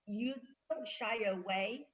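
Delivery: usual speaking voice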